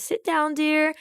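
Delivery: high, sweet governess voice; gentle governess. Retold or imitated. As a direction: soothe her like a kindly old governess. Miss Heliotrope gently calms Maria.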